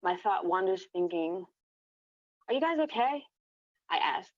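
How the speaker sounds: usual speaking voice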